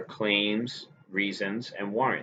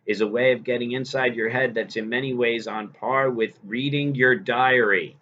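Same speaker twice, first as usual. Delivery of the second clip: louder, raised read-aloud voice to the class; reading a passage aloud; 4 semitones higher, 4 dB louder than usual. Acted. Teacher reading the essay aloud to her students, raised and louder. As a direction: read it out with energy, like quoting the text.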